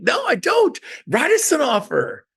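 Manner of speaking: sneering amateur agent impression; mock amateur. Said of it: "That's what amateurs say".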